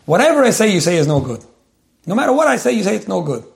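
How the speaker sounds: put-on a boy at the end of his rope, pitch shooting up; imitating a fed-up little boy complaining; frustrated child. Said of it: "the kid got so frustrated".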